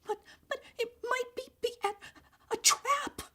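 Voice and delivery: very high, tearful, broken stammer; frightened stammer. A narrator's reading. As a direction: stumble over the words in fear. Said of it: "Violet stammered".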